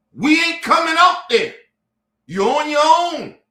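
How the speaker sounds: loud declaration of refusal; defiant shout. Real, not acted. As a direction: slam the door on them vocally.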